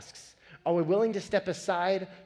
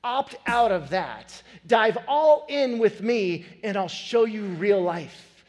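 slow, louder invitation; Jesus inviting the listener to dive in; 5 semitones higher, 6 dB louder, slower than usual. Acted. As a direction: call them in slowly and firmly. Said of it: "And Jesus steps in and says".

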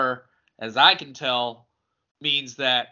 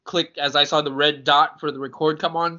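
normal talking voice

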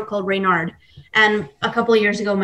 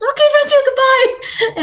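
very high, louder, rushed thanks while fleeing; panicked high-pitched goodbye to the bakery lady; flustered squeak; 4 dB louder than usual. Retold or imitated. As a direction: squeak it out like you want to bolt from the shop. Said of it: "And I was like".

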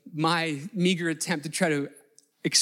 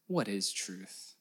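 Pilate's question said much softer and lower; hushed, slow question; 7 semitones lower, 7 dB quieter, much slower than usual. Retold or imitated. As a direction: let it sink, slow and quiet.

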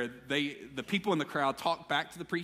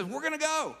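high-pitched, eager declaration; rally the group; acted eagerness, voice lifted high; rallying call; 11 semitones higher than usual. Jesus rallying the disciples, retold.

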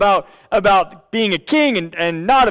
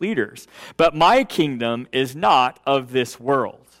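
scripture reading; 3 semitones lower than usual. Acted. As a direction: read the passage with hushed reverence. Reads scripture aloud.

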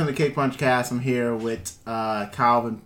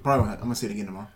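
rushed; 2 semitones lower, much faster than usual. Real, not acted. Rushed correction.

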